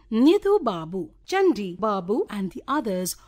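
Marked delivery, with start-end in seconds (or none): none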